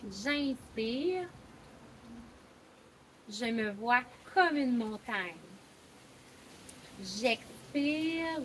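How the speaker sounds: slow, measured recitation with long pauses; guided breathing meditation. Acted. Coach leading a conscious breathing poem in mountain pose, slow meditation voice. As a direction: lead each breath calmly, phrase by phrase.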